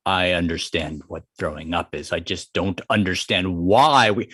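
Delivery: low-pitched Tiger voice, puzzled; reluctant Tiger. Acted. Tiger to Frog about the vomiting contest, low and puzzled.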